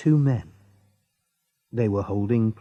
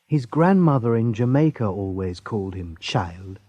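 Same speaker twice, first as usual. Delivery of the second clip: usual speaking voice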